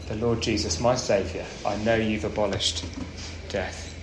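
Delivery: a lightly voiced condemned man praying under his breath; quiet prayer. Paul in his cell before execution, praying to Jesus, hushed.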